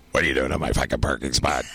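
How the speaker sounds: lightly voiced gangster boss, low and quick; a hint of a tough boss, rattled off low. Boss confronts new hire over parking spot, lightly voiced gangster. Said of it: "this total gangster record guy".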